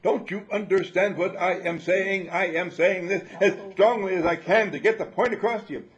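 shouting in capitals, loud and high. Retold or imitated. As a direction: hammer every word like all caps. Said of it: "you're shouting at somebody".

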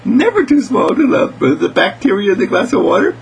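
put-on high, eager girl's voice; excited little girl. Mary Lee lists little creatures to love, high and eager. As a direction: pipe it out with childlike enthusiasm.

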